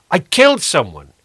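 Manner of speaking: high, loud anguished shout. A narrator's reading. Stranger shouting his confession to the priest in the church. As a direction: cry it out so it echoes. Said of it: "he shouted".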